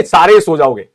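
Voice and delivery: ordinary delivery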